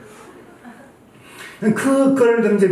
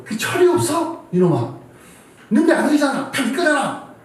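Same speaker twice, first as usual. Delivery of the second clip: high, pretend rebuke of a son; hammed-up imagined telling-off; mock angry scolding; 8 semitones higher than usual. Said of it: "나 같으면 진짜 막".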